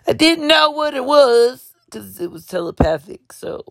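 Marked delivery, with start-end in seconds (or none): 0.1-1.7 high, slow, badly faked country accent. Wrangler answering telepathically in a dream, high mock country accent. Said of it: "I can't do a country accent".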